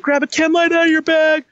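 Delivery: very high-pitched imitated screamed orders; retold screaming. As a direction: yell urgent instructions like a panicked sergeant. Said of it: "screaming instructions at me".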